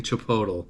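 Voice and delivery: "Chipotle" said as chi-POH-tul; deliberately wrong chi-POH-tul for "Chipotle"; joke mispronunciation. Shown as a demonstration. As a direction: end "Chipotle" in -tul, like bottle. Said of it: "At least I'm not saying".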